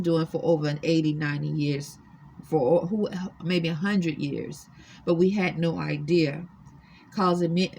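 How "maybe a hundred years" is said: ordinary talk, nothing marked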